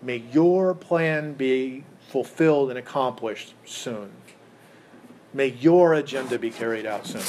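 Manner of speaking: YOUR punched and stretched in each petition; stress on YOUR. Shown as a demonstration. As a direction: press hard on "your". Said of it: "It's about God".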